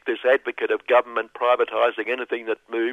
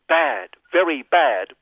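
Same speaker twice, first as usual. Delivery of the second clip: mock Trump; 5 semitones higher than usual. Mimicking Donald again.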